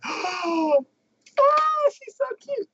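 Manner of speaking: high gushing gasp; squealing fangirl coo. Retold or imitated. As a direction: coo over the cute little girl. Maid gushing over girl, retold.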